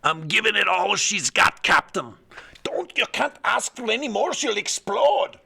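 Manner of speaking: loud, lightly Scottish engineer straining; Scotty impression. Streamer answering captain as Scotty, a hint of Scottish engineer. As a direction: play Scotty pushing the engines. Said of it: "So I got some Murphy in me".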